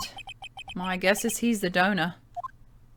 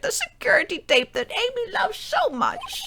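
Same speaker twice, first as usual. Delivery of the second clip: high, theatrical judge; 10 semitones higher than usual. Acted. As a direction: read it as the stern judge. Reads the judge's lines in voice.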